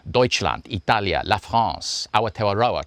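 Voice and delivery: put-on Deutschland, Italia, La France, Aotearoa each in its own accent; native pronunciations. Defiant voiceover artist lists countries, each in native pronunciation. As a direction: roll out each name as a local would. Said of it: "like a man doing voices".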